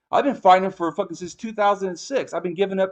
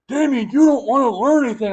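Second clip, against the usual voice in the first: higher, louder mock accusation; mocking critics; 7 semitones higher, 4 dB louder than usual. Mocking people who say he won't learn, higher accusing voice. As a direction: parrot the critics with scorn. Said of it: "They go".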